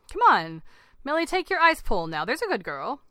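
loud, insistent coaxing; demanding. A narrator's reading. Dad shoving an ice pole at his daughter, demanding. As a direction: push it firmly, easing at the end. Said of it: "demanded Terry".

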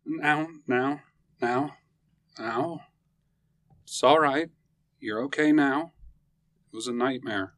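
soothing. Acted. Husband soothing wife.

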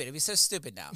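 normal talking voice

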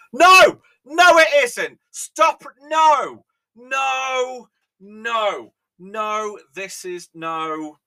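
very high, very loud mock-furious shouting; hammed-up outraged shout. Tea lover shrieking at an American's tea video, mock fury. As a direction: scream no like you've seen a crime.

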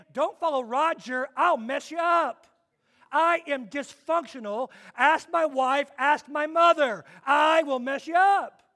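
half-joking, loud warning about yourself; hammed-up loud self-warning; mock warning. Pastor mocking himself, warning.

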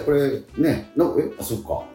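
ordinary delivery

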